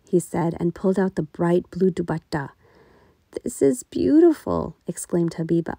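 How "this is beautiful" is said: higher, louder, pleased; a mother thrilled with a gift; delighted mother. A narrator's reading.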